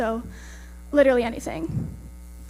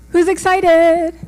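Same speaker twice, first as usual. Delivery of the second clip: slow, loud crowd hype; rally the room; excited hype; 3 semitones higher, 6 dB louder, much slower than usual. Real, not acted. Hyping audience before speech.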